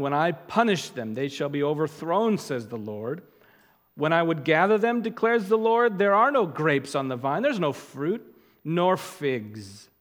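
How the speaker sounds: prophet's warning read aloud from the pulpit; forceful scripture reading. Acted. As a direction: declaim the prophet's words to the people. Declaims Lord's judgment.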